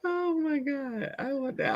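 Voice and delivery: high, slow, laughing; laugh-crying. Real, not acted. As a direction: crack up mid-word.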